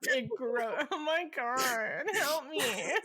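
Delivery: breaking up with laughter; laughing helplessly. Real, not acted. Giggling fit continues.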